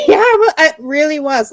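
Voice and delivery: high, loud laugh through the words. Real, not acted. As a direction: giggle out the confession. Laughing admitting to Layla.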